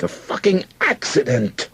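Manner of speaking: high, strained angry shout; shouting back. A narrator's reading. Soldier defending himself to his friend, shouting back. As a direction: insist on it at a strained yell. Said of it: "The soldier shouted back".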